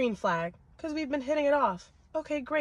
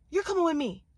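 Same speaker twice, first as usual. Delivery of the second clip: higher-pitched, forceful order; insistent demand; 4 semitones higher, 3 dB louder than usual. Retold or imitated. Woman claiming the man in the car, forceful demand. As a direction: demand it, pitch up and falling hard.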